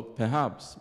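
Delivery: usual speaking voice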